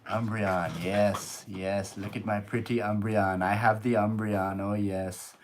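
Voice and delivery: ordinary talk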